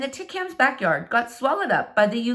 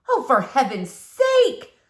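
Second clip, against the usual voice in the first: slow, louder exasperated sigh of a line; exasperated neighbour; 3 semitones higher, 4 dB louder, slower than usual. Acted. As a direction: drag it out, fed up. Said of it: "said Missus Tickham".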